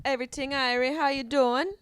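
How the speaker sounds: put-on loud, bright Jamaican accent greeting. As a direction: answer the phone in a Jamaican accent. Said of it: "Answer with a Jamaican accent".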